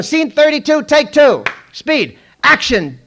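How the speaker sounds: loud high set call; slate call. Retold or imitated. Clapper girl slates take two, loud high call.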